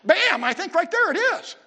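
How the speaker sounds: loud, high burst of discovery; excited exclamation. Real, not acted. Preacher pointing the class to the answer, loud and excited. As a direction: land it like a triumphant reveal.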